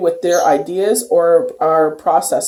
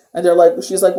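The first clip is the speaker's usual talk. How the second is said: ordinary talk, nothing marked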